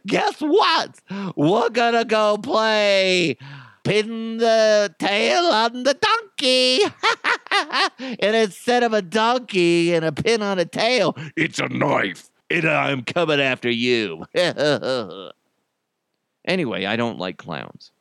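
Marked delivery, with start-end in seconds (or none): 0.0-8.2 very high, drawn-out clown voice; creepy clown. Acted. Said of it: "like a creepy clown". 8.2-14.2 menacing clown, starting high and sinking; hammed-up killer clown voice; creepy clown. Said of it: "like a creepy clown". 14.3-15.3 sinister hammed-up clown laugh; evil clown chuckle. Clown laughs after threat.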